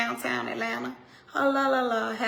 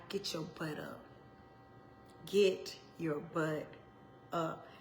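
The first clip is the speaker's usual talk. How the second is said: soft slow charge; 5 semitones lower, 11 dB quieter, slower than usual. Real, not acted. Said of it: "slow soft closing charge".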